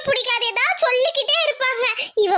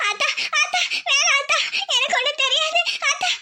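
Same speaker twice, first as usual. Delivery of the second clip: higher voice broken by sobs; sobbing plea; 3 semitones higher than usual. Acted. Daughter swearing to mother she knows nothing, sobbing plea. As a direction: plead tearfully, words breaking up.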